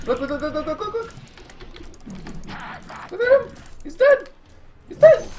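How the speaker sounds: squealing with hype at the kill; excited yelling. Real, not acted. Hyped watching a kill.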